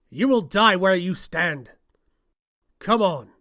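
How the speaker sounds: slow, loud menacing threat; loud villain threat. Acted. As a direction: boom it at your enemy. Game villain threatening and taunting.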